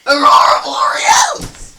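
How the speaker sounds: screaming mock documentary host; crying shout. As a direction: wail it as you wake up. Sedated narrator cries out.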